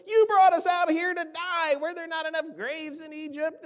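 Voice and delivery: high mocking whine of complaining Israelites; whiny complaint. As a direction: play the ungrateful people, whining high. Preacher acting complaining Israelites.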